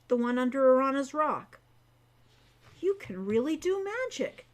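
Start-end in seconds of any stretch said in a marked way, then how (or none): 2.8-4.5 stunned. Acted. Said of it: "sounding stunned".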